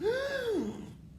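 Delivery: loud, high shocked gasp; big dramatic intake of breath; great gasp. A narrator's reading. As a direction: gasp like a horrified audience. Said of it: "A great gasp".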